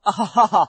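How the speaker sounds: forced laughing fit; helpless laughter. Acted. Henchman under a laughing spell, giggling helplessly. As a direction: laugh as if you can't stop. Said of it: "I'm dying of laughter".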